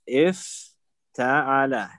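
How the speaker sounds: افتعل said slowly, split after the first syllable; drawn-out syllables. Shown as a demonstration. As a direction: break افتعل into slow pieces, if-ta'ala. Teacher sounds out the verb pattern.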